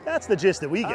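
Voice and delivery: ordinary talk, nothing marked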